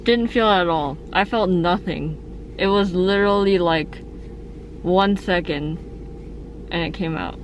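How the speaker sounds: words muffled by gauze in the mouth; talking through gauze. Real, not acted. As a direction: mouth full of gauze after the extraction. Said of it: "farting on a piece of, uh, gauze right now".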